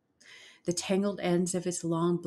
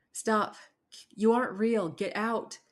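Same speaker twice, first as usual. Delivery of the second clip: higher-pitched, urgent cry; frightened protest; 5 semitones higher than usual. A narrator's reading. Mina orders the creature out, urgent and raised. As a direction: push the intruder away with the voice.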